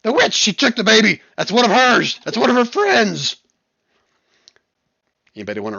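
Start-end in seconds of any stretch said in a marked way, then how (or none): 0.0-3.4 high, loud, outraged shriek; screamed accusation. Acted. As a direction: scream the accusation for all to hear. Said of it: "in their vines start screaming".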